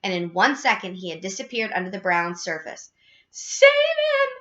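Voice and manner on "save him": very high, loud wail; panicked cry. A narrator's reading. Missus Gloop sees her son go under, panicked cry. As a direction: scream for rescue. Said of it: "cried Missus Gloop".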